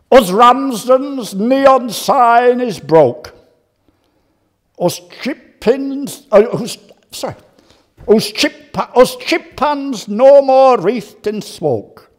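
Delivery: slow, hammed-up Yorkshire dialect lament; poem recitation. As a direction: declaim it like a solemn funeral ode. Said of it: "here's my lines".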